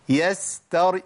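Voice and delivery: normal talking voice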